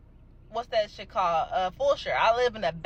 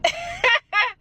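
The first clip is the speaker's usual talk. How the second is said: high disbelieving cackle; a burst of incredulous laughter. Real, not acted.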